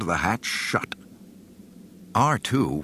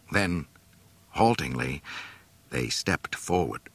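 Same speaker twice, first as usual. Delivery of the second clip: plain reading voice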